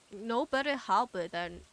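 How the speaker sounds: normal talking voice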